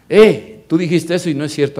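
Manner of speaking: a sharp, loud, fast "Eh" to open, then a quick protest; mock confrontation, blurted loud; sudden loud challenge. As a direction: call out the liar in front of everyone. Calling out a false teacher.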